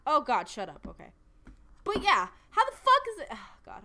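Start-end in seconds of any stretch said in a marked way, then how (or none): 1.8-3.3 high, loud outburst; furious shout. Real, not acted. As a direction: yell in disbelief. Continued pronunciation outrage.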